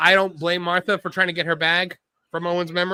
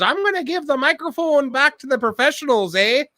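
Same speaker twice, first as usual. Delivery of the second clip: put-on Canadian accent; 9 semitones higher than usual. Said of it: "she goes".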